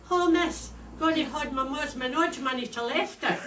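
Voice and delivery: put-on high and loud broad Glasgow girl; broad Glasgow schoolgirl. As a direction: pipe up like a Glasgow pupil handing over money. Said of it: "asked her".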